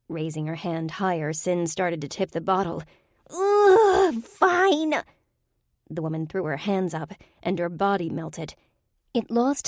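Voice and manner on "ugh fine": very high, loud grudging whine; high sulky give-up. A narrator's reading. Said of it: "two octaves higher and painfully nasal".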